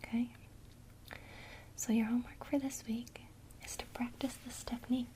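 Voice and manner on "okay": ordinary delivery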